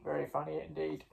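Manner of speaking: low, quick, soft character voice; Bobby's reply. Pretend. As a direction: answer in a low, hurried put-on voice.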